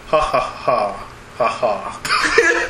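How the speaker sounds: low, slow villain cackle; evil laugh. Acted. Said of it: "they do that evil laugh".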